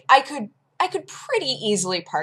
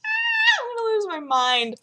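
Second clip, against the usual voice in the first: pitched-up mock despair; whiny dismay. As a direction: moan that it's ending too soon. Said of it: "and I'm just like".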